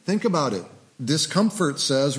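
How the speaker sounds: ordinary delivery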